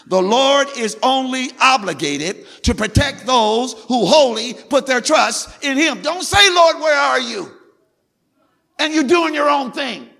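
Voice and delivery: full-force preaching. Real, not acted. Preacher warning the congregation about trusting God, full-force preaching. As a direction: drive each phrase home at a high shout.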